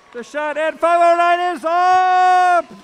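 loud, high, drawn-out play-by-play call; excited shout. Real, not acted. Commentator calling five oh nine's climb, an excited shout. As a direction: belt out the big climb, stretching the words.

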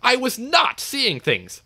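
high, slow, emphatic indignation; huffing protest. A narrator's reading. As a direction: huff each word in offence. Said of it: "huffed James".

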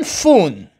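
فون drawn out with an initial f. Shown as a demonstration. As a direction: say فون slowly, "foon", ph as f.

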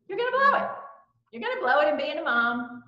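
high sing-song taunting voice; mocking inner voice. Mom mocking herself in a high taunting inner voice. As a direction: needle yourself in a high mocking tone.

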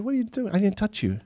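put-on high protesting kid voice; indignant older brother. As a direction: deny it, outraged.

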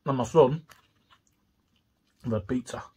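speaking between bites; chewing while talking. Real, not acted. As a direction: mouth partly full. Seen 5: Bites food at -2s, jaw and lips keep working through speech.

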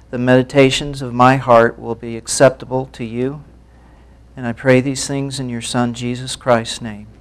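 ordinary talk, nothing marked

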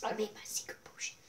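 hushed secret whisper. Real, not acted. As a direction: breathe it like a secret.

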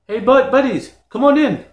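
high, loud call to friends. Acted. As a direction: summon your buddies from across the room. Calls friends to come in.

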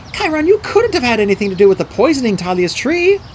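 put-on high, incredulous girl's voice; crying out in protest. A narrator's reading. Girl defends her mentor, crying out in disbelief. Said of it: "Annabeth cried".

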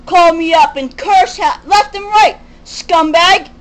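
loud, high-pitched furious rant; angry shouting. Real, not acted. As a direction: hurl it out like an accusation. Angry rant about abusive caller.

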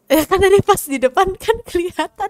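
high, loud, put-out complaint; exasperated protest. Pretend. Player to partner who missed the spot right ahead, exasperated. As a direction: protest loudly that it was obvious.

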